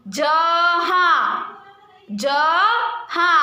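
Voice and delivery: जहा stretched very slowly, nasal आ held long; drawn-out demo word. Shown as a demonstration. Teacher to class modelling the nasal ending, slow जहा. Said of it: "हा के पीछे भी आ का pronounce".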